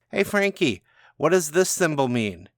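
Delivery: put-on high, loud teenage taunt across the street; teen calling out. Teen heckling Frankie from the street, high mocking call. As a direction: jeer at the wizard like a cocky kid.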